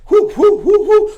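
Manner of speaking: high, loud whoops; cheering. Real, not acted. Host whooping while swaying side to side. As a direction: whoop it up like at a concert.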